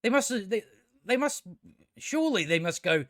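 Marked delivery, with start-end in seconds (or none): none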